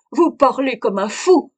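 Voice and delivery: loud, high, heated retort; angry outburst. Acted. Old man's outburst continues.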